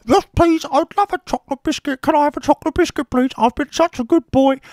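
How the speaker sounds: put-on high, pleading good-boy voice; hammed-up childish begging; mock eager child. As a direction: beg for the biscuit like an eager kid.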